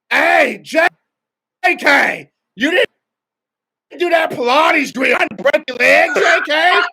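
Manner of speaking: loud, high hollering strength coach, calling out to the media; Scott Cochran impression. Strength coach yelling at reporters and players at practice, loud and high. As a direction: shout it across the facility like a hyped coach. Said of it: "pretty good impersonation".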